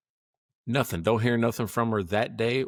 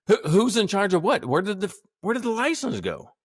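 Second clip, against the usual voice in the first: exasperated; 10 semitones higher than usual. Real, not acted. Exasperated about the lost license.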